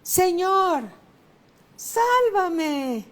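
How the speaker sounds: high, loud, drawn-out cry for rescue; grito de auxilio. Acted. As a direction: cry out to be heard by the Lord. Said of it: "yo subo un poco el tono de la voz". (clamor en voz alta y aguda)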